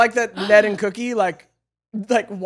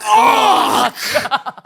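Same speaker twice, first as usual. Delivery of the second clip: high mock cry, amused; hammed-up, drawn-out imitation squawk; full-body yell; 11 semitones higher, 3 dB louder than usual. Co-host copying the actor's full commitment, high mock yell. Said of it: "with his full body".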